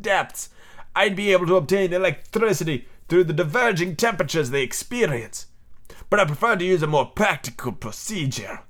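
put-on lilting, hammy foreign accent for a sea captain; theatrical captain with an unplaceable European accent; mystery foreign accent. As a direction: explain it in a wandering, vaguely French accent. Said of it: "I don't know what accent this is".

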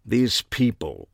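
slow, disapproving British; affected British, slowly dismayed; affected British accent. A narrator's reading. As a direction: draw it out with British disdain. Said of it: "either a British accent or a deep-seated affectation".

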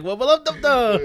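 high, loud cartoon catchphrase; laughing high mock Rick cry. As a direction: squeal it like the cartoon. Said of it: "end off the whole fucking episode".

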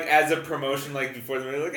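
normal talking voice

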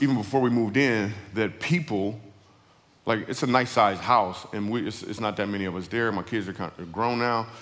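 usual speaking voice

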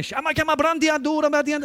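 hammed-up imitation of a man praying in tongues; imitated speaking in tongues. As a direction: mimic tongues loud and high. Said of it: "walking around going".

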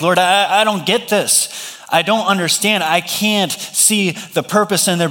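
high-pitched, pleading bewilderment; questioning the Lord. Acted. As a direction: protest to God like a confused child. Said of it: "we're questioning the Lord".

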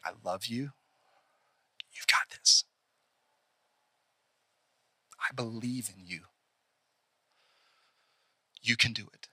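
hushed, low and sincere; soft, heartfelt reassurance with long pauses; tender encouragement. Acted. Someone holding your shoulders in life's challenge, tender encouragement.

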